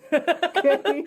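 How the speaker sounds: high, loud laughing plea; giggly high protest. Real, not acted. Laughing defence of bar life.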